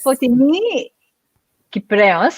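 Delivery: put-on a light playful Greek accent on the name Fotini Kyprios. Host playfully says guest's Greek name with a light Greek accent. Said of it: "with a Greek accent 'cause I can".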